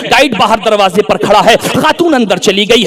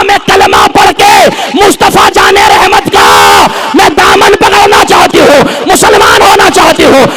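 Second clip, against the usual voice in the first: slow, shouted preaching delivery; impassioned declaration; 4 semitones higher than usual. Acted. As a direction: draw out her declaration like a rousing preacher. Preacher voices woman's declaration.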